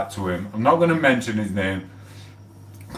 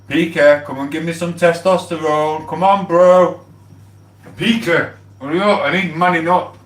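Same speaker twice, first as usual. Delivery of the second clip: loud, higher shout of names; calling out; 7 semitones higher, 5 dB louder than usual. Real, not acted. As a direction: call across the room to your mates. Calling PK to come over.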